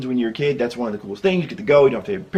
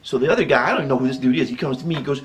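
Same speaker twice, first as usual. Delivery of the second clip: ordinary talk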